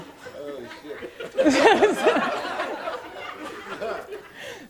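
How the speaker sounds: trailing real laugh, fading. Real, not acted. Still amused telling her friend, fading laugh. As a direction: let the laugh die down.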